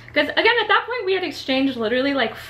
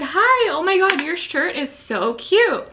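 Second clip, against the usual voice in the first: bubbly greeting; 6 semitones higher, 3 dB louder, slower than usual. Retold or imitated. Retold cheerful greeting to stranger.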